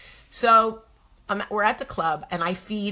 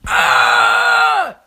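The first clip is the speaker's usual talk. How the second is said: put-on high loud kid war scream; girl's battle cry before punching; 11 dB louder than usual. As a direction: let out a little warrior's battle yell. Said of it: "battle cry".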